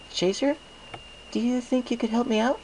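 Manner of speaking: high, coaxing mare's voice; Twilight's voice. Acted. Twilight asks a favour.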